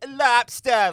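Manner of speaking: put-on overdone Boston accent on "Lobster"; a loud, hammy Boston "Lobster"; bad Boston accent. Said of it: "I just did a bad Boston accent".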